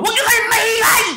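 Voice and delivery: high, loud mock yell; imitated shout. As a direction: bark it at the noisy friends. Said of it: "sumigaw yung isang kasama niyo".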